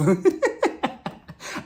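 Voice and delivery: high breathy giggle; delighted giggle. Real, not acted. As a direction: giggle at the drama. Laughing at the showdown.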